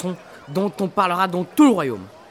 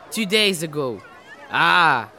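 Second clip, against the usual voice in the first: ordinary delivery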